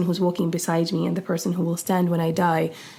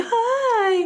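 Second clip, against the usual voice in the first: fake friendly. Two-faced greeting when someone arrives.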